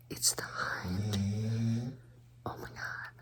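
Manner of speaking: hushed whisper, husband asleep nearby; quiet at night. Real, not acted. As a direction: whisper so nobody wakes. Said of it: "I can't talk too loud 'cause my husband is asleep".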